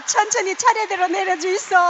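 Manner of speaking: Gyeongsang-accented mock announcer; Gyeongsang dialect announcement. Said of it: "경상도 사투리로 하는 거죠".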